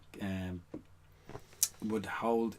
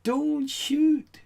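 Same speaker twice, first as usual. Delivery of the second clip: put-on much higher, louder shout of a small Northern Irish boy; little boy shouting; 9 dB louder than usual. A small boy in a silent crowd calls out during the gun scene, in a much higher, louder Northern Irish child's shout. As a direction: cry out high like a frightened little Belfast boy. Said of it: "little boy just shouts out in a Northern Ireland accent".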